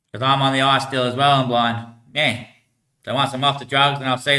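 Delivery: ordinary talk, nothing marked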